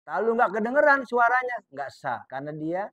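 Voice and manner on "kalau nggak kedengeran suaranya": ordinary talk, nothing marked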